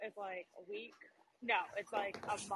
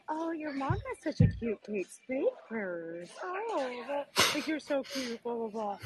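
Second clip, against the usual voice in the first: high, drawn-out gushing coo in mimicry; mock-sweet baby talk to a pet; cooing vet; 8 semitones higher, 5 dB louder, slower than usual. Vet petting the dog and admiring the purse, mock cooing.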